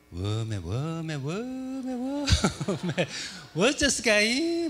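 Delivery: slow, high, drawn-out Jeolla cries of wonder; Jeolla dialect exclamation. Retold or imitated. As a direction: Jeolla audience member calling out in amazement mid-show. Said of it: "막 이렇게 다 들려요".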